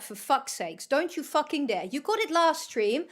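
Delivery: ordinary delivery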